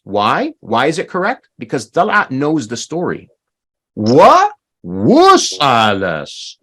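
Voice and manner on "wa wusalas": "Wa wusalas" very slow, "Wa" and "wu" leaned on; emphasised correction. Shown as a demonstration. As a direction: stretch and punch each part of "Wa wusalas". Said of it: "You see how I emphasize that?".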